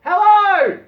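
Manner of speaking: a high, loud called greeting; yell. Acted. Dave calling to the man in the boat, loud and high. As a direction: call out from offshore. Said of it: "in the boat replies".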